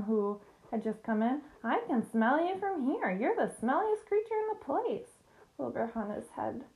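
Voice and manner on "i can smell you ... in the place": high-pitched, snooty lamb voice; little lamb jeering, pitched well up; scornful lamb. A narrator's reading. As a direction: taunt the pig in a high voice. Lamb insults the pig.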